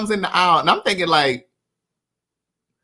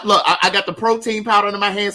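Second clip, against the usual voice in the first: ordinary talk, nothing marked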